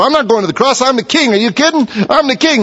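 high-pitched mock indignation; defiant refusal. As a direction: play an indignant king refusing, pitched well up. Imagined defiant Jesus refusing the cross.

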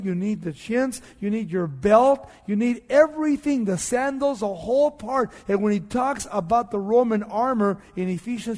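forceful preacher listing the armor; building sermon. Acted. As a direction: drive each item home with rising force.

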